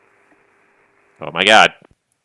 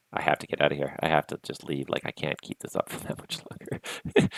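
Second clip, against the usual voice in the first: sleep deprived; 3 semitones lower, 8 dB quieter than usual. Real, not acted. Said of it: "I'm, I'm delirious".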